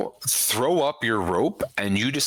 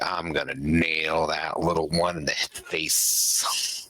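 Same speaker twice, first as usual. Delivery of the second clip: lower, slow smug spider voice; 5 semitones lower than usual. Acted. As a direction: taunt him lazily. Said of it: "And he's like".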